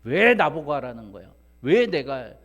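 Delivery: loud, indignant protest; acted anger. Preacher acting someone told to do the job, angrily protesting. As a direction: push back hard, as if unfairly ordered. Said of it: "내가 하려고 하니까 화나는 거예요".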